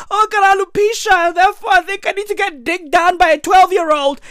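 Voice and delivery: high, rushed mock lament; mocking Jada. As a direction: whine the excuse up high, making fun. Mocks Jada's excuse.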